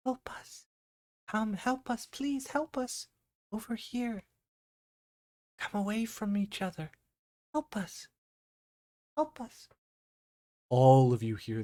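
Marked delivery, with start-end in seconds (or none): 0.1-9.7 put-on high, soft, eerie child voice; childlike whispering voices. Childlike voices luring campers from the woods, high hushed pleas. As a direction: plead softly like small children in the dark. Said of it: "childlike voices would begin to whisper".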